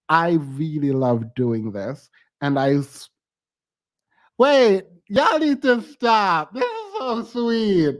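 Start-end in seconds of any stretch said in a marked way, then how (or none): 4.4-8.0 high, slow, touched and fighting tears; overwhelmed by kindness, voice wobbling up high; choked up. Real, not acted. Viewers' gifts overwhelm the streamer.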